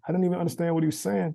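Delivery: ordinary talk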